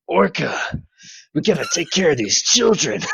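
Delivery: a touch of a soldier voice; lightly voiced old soldier; Snake voice. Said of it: "snake boss, man".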